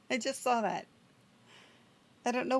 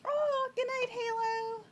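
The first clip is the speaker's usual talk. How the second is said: high sing-song goodbye; 13 semitones higher, slower than usual. Real, not acted. Cheerful goodbye to a player.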